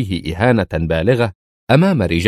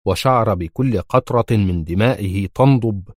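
plain reading voice

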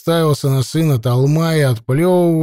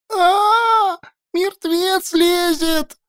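высокий вопль ужаса; заорать от страха, тянуть слово; испуганный крик; much slower than usual. A narrator's reading. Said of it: "заорал Веська". (вопль в ужасе)

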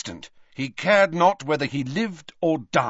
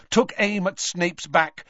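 ordinary delivery, nothing marked

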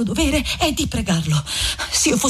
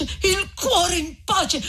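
very slow, higher, crying through it; voice breaking; 7 semitones higher, much slower than usual. Acted. Lucia repeating her plea to Renzo, slow and crying. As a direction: let the repeat dissolve into tears.

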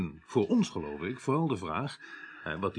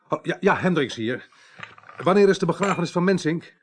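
brisk loud phone call; 8 semitones higher, 6 dB louder than usual. Acted. Urgent phone call from the chief.

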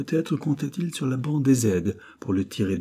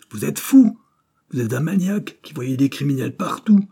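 louder, higher furious outburst; yelling; 6 semitones higher, 6 dB louder than usual. A narrator's reading. General yelling at Broquet, furious and loud. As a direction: hurl the accusation at him. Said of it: "hurla-t-il".

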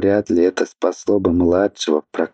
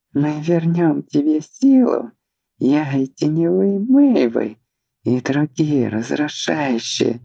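high, devout chanting plea; reverent sing-song; 9 semitones higher than usual. Acted. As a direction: sing out in awe. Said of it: "благоговейно запел Марквин Сент".

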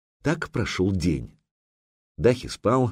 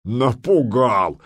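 slow, scornful laughing retort; Viking chuckling at his captors; mocking chuckle; 3 semitones higher, 4 dB louder, much slower than usual. A narrator's reading. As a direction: laugh off the threat. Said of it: "хохотнул Медвежья Лапа".

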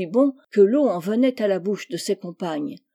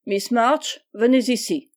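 firm, raised schoolmaster's order; stern summons; 3 semitones higher, 4 dB louder than usual. A narrator's reading. Angry schoolmaster to guilty pupil, stern. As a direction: summon the culprit to the front.

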